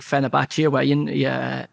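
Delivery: ordinary talk, nothing marked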